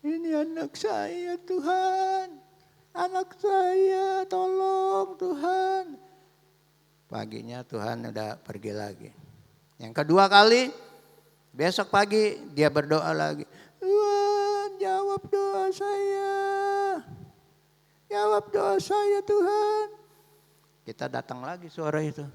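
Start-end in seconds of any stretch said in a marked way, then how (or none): 0.1-5.8 ratapan ibu bernada sangat tinggi, diseret; menangis memohon. Acted. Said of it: "Dia nangis saja". 13.8-19.9 rengekan doa bernada sangat tinggi, lambat; doa sambil menangis. Acted. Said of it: "Dia nangis saja".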